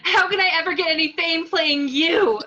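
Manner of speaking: loud accusing shout; angry outburst. Acted. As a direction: lash out in outrage. Jen outraged at Gale.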